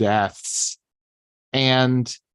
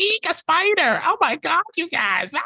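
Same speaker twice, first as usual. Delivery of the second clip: much higher squealing panic over a spider; mock girly shriek. Mocking Mickey's panic at a spider, squealing high. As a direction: shriek it like a hammed-up damsel.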